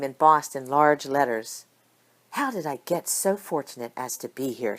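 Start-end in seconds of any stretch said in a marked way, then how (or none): 2.3-4.8 soft and hushed, to herself; quiet inner thought. A narrator's reading. Micah thinking to herself before her speech, softly amazed. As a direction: murmur it quietly in wonder. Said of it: "she thought to herself".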